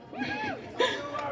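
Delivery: a sudden squealing laugh; high burst of laughter. Real, not acted. Host laughing at own planning flaw, high laugh.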